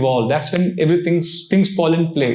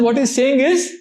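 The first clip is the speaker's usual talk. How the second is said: usual speaking voice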